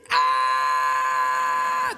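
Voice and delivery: high, sustained comic scream; long mock wail. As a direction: wail on one held high note. Mimes child hauled out wailing.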